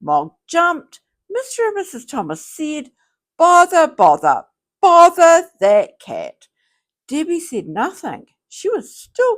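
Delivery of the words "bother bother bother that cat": louder, furious bother bother; angry outburst. Acted. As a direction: scold the cat, woken and fed up. Both parents woken at night.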